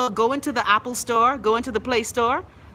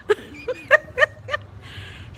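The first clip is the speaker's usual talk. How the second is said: high wheezing laugh. Real, not acted. Laughing at her story.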